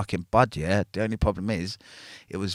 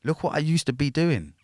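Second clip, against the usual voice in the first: retold indignant reply; 6 semitones higher than usual. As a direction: throw it back at the guards, half-acted. Retells his indignant reply to guards.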